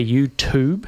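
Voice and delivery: "YouTube" with a plain T, as "you-toob"; young people's pronunciation. Shown as a demonstration. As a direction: say "YouTube" slowly, ending in "toob". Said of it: "young people these days say".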